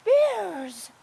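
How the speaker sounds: high, small cry of alarm; wee little voice. A narrator's reading. Bernadette waking to see bears, wee high voice. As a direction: squeak it out in a tiny high voice. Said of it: "in a wee little chi-sai voice".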